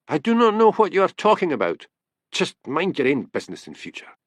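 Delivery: put-on stiff Highland accent, pitched high; pronounced Highland accent. A narrator's reading. Policeman telling the seer off in a pronounced Highland accent. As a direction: thicken the Highland accent in indignation. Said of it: "Hamish's Highland accent was pronounced".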